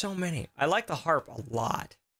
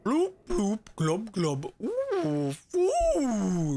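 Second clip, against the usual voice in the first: high bubbly monster fish voice; gurgling fish man croak; 8 semitones higher, much slower than usual. Pretend. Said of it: "It's an actual angler nightmare".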